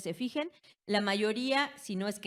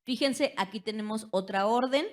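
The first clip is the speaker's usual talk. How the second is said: ordinary delivery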